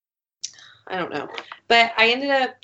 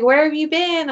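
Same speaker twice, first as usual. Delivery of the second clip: higher, louder whiny question; mock needy demand; 8 semitones higher, 4 dB louder than usual. Mocking the guy asking where she has been, higher whine. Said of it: "He was like".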